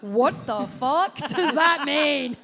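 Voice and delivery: loud, slow incredulous question; mock bafflement. Real, not acted. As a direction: demand an explanation, drawing out each word. Host jokingly demands show title's meaning.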